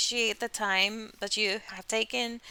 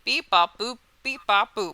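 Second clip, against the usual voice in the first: louder beep-bop robot chirping; 7 dB louder than usual. Acted. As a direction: chant beeps like a glitching robot. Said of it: "It's a new, new kind of scatting".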